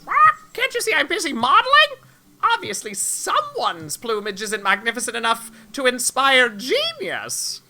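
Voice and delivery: high, pompous squawking rooster; a vain rooster artiste, squawked high. Acted. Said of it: "I'm gonna do this rooster voice".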